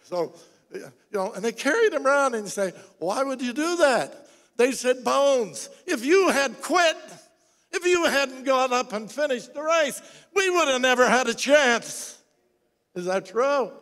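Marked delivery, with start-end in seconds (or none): 3.0-12.2 higher, louder, eager voice of the teammates; excited team. Retold or imitated. Teammates thanking Bones, eager and loud. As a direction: sound like grateful teammates cheering.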